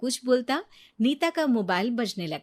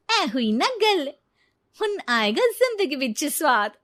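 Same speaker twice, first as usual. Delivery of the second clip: gloating; 5 semitones higher, 5 dB louder than usual. Acted. Thief bride gloats over the vault keys, loud and pleased. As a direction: savour the win, scheming.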